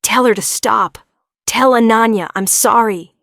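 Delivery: loud frightened begging; desperate plea. A narrator's reading. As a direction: beg in panic. Hallucinating prisoner begs.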